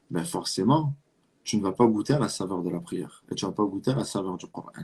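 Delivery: usual speaking voice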